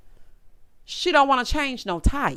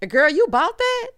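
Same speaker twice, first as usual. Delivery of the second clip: incredulous; 8 semitones higher, 5 dB louder than usual. Real, not acted. Reacting to Sierra believing Maurier.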